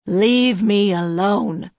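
put-on slow, loud old man's bellow; hoarse bellow. A narrator's reading. Cornered old man driving off his questioner, hoarse bellow. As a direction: bellow it hoarsely, drawing out each word. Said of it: "Porter bellowed hoarsely".

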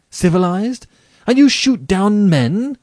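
loud, high-pitched outraged questions; indignant disbelief. Acted. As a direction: throw the word back in outrage. Rainsford is outraged at the General.

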